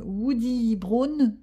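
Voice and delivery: joking French take on English; French-accented English. Real, not acted. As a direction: spell out the English colour name slowly in a proud French accent. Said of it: "mon accent est toujours magnifique".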